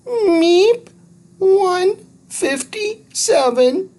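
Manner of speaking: very high squeaky creature voice; little alien. Acted. Thud correcting Andrew about Harley, squeaky and high. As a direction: chirp it like a tiny alien.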